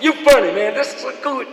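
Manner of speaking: loud, high, gleeful caller voice; excited caller. Retold or imitated. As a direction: shout it like a thrilled fan who caught him. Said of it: "he said".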